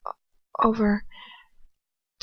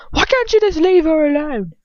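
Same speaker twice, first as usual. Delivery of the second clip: much higher, louder, near-shrieking shout; furious shout; 10 semitones higher, 7 dB louder than usual. Acted. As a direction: shriek it in outrage. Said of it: "Ron shouts at him".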